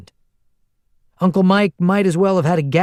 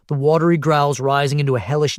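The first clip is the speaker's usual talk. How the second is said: ordinary delivery, nothing marked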